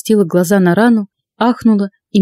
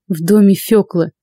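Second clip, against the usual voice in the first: ordinary delivery, nothing marked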